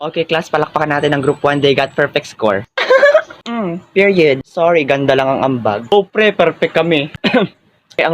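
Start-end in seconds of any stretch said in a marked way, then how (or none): 2.7-3.2 high loud gleeful laugh; high victory cackle; triumphant laugh. Acted. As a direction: crow with delight. Group wins perfect score.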